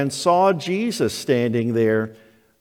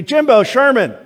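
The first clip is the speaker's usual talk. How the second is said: higher, louder call out the back door; raised, singling-out motherly call; mom calling again; 7 semitones higher, 4 dB louder than usual. Retold or imitated. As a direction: imitate mom calling the boys, more insistent. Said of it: "it would be".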